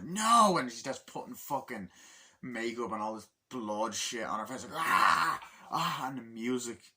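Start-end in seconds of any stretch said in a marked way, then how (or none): 0.0-0.6 a sudden high, loud shriek of refusal; shouting no. Retold or imitated. Mother refusing her boyfriend's calming, screaming. 4.7-6.1 high, loud anguished cries; frenzied cries. Retold or imitated. Mother smearing makeup in a frenzy, crying out.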